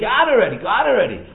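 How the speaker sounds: high, loud impatient heckle; imitated shout. People of Bavel hurrying the man out, imitated shout. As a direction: imitate onlookers shouting him on his way. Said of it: "He used to shout, he used to say".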